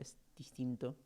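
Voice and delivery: usual speaking voice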